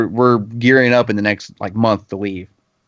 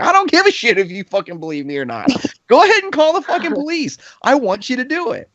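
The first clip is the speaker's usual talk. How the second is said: much higher, strained mock shout; high, defiant imitation of his own rage; mocking angry yell; 13 semitones higher than usual. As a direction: dare him to call the police. Yelling back at the father.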